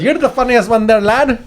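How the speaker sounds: high, light Irish lilt; mock Irish accent. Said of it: "she goes".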